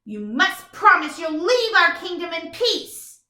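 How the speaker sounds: firm princess command. Shown as a demonstration. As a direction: demand it like a brave princess. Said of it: "the princess tackled down that dragon".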